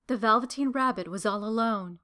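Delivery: ordinary delivery, nothing marked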